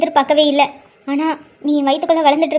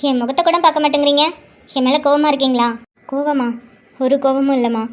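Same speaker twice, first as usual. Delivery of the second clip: ordinary delivery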